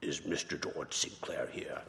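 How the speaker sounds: low, hushed, half-whispered Oxbridge imitation; a quiet mock of a deep Oxbridge voice, barely voiced; breathy low-key Oxbridge caller impression. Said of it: "He had a deep Oxbridge voice".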